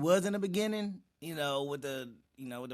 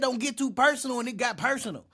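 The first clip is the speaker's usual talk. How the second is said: louder and higher, driving the irony home; worked-up emphasis; 7 semitones higher, 6 dB louder than usual. Real, not acted. Reactor telling viewers the question got too personal, worked up and louder. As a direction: hit it hard like a punchline.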